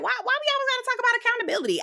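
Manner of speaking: high, fast whiny retort; mock her defensive whine, rushing; hammed-up defensive wife; imitating Tisha. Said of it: "She was like".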